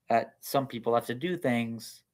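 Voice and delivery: ordinary talk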